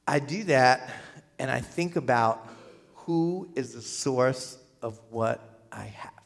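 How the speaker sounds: low, soft, slowing almost to a whisper; hushed reflection. Acted. Giver pondering the source of what they have, hushed. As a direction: let it sink to a reverent hush.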